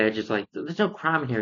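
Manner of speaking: ordinary delivery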